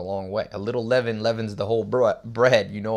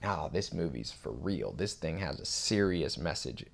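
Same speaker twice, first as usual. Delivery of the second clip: retold answer, dropped low and soft; hushed, deep-pitched seriousness; low, earnest reply; 5 semitones lower, 10 dB quieter than usual. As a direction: lower your voice to insist it's serious. His serious reply to his date.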